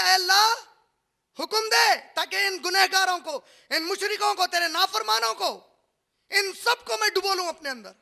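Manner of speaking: high, loud plea, slowed on the call; water pleading loudly. Acted. The sea begs God to drown sinners, loud pleading. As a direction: cry out to God as the angry sea. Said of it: "ये पानी कह रहा है".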